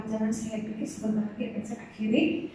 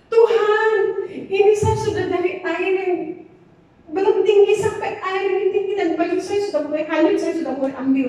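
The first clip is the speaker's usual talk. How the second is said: desperate prayer; 5 semitones higher, 9 dB louder than usual. Retold or imitated. Crying out to God by river.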